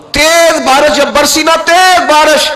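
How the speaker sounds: slow, high, loud emphasis on تیز; impassioned preaching. Acted. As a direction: stretch out تیز to paint the downpour. (تیز پر زور، بلند اور دھیما)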